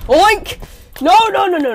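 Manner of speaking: high loud oink then frantic noes; panicked yell. Real, not acted. Kid yells high and loud as the pig player kills him. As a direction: yell it high, losing the fight. Gets killed in the game.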